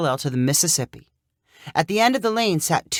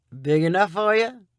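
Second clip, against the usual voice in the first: amused; 3 semitones higher than usual. A narrator's reading. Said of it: "Sonny asked with a laugh".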